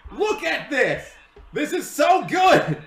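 high, loud, slowed delight; excited shout. Real, not acted. Fan thrilled by three-wide racing, excited shout. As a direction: savour the thrilling pack racing.

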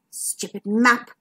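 slow, disgruntled grumble; grumbling. A narrator's reading. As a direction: grumble it slowly to yourself. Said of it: "grumbled McMeer".

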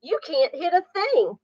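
high mocking bird voice with a jeering laugh; cocky blue jay jeering from above; taunting blue jay. A narrator's reading. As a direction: tease the kittens from the tree. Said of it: "said a voice".

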